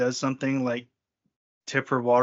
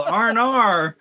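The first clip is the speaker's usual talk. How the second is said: higher, slurred R and R; slurred Australian; 6 semitones higher, 5 dB louder, slower than usual. Shown as a demonstration. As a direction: mush the words like an Aussie. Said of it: "kinda slurred".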